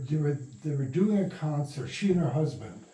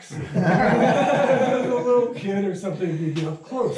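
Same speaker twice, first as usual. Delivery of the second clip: laughing; 8 semitones higher, 7 dB louder than usual. Real, not acted. Laughing while describing the film.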